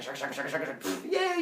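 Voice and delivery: ordinary talk, nothing marked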